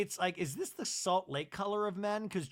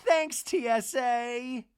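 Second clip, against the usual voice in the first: loud, slow, higher mock announcer delivery; cheesy punchline; 7 semitones higher, 7 dB louder, much slower than usual. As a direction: land a corny joke like a TV host. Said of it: "And then she goes".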